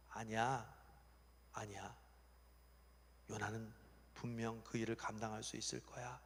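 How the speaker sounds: low, soft, patient insistence; acted calm reassurance; reassuring God. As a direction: quietly stand by him. God reassures the protesting angels.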